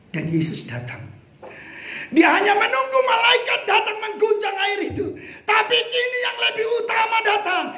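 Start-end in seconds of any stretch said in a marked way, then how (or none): 2.1-7.6 preacher announcing that Jesus comes today, shouting; full-force preaching. Acted. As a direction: proclaim it with rising fervour.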